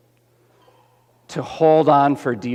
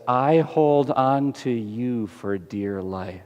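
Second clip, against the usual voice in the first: tender promise; 3 semitones lower, 4 dB quieter, slower than usual. Acted. Jesus voiced promising to hold on.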